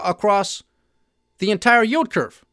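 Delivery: normal talking voice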